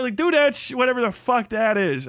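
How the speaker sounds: ordinary talk, nothing marked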